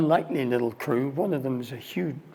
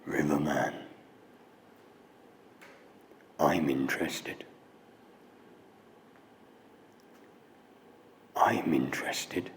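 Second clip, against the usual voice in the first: gentle mystic; 10 semitones lower, 4 dB quieter than usual. Retold or imitated. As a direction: answer him softly and deeply. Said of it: "the voice spoke again".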